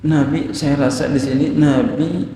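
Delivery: ordinary talk, nothing marked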